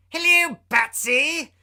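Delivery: hammed-up villain calling out; Joker's shout. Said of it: "he shouted".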